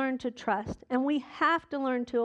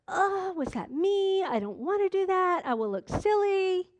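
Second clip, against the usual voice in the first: higher, startled inner voice, mildly mocked; hesitant believer; 7 semitones higher than usual. Congregant mimicking their own doubting thoughts, higher and startled. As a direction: act out a nervous churchgoer second-guessing God. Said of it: "you're like".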